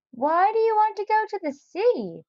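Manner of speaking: put-on high, sharp old neighbour's demand; Mister Barley Bean demanding. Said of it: "demanded old Mister Barley Bean".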